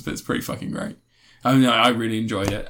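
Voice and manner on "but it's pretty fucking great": whispered aside. Real, not acted. Aside after not spoiling.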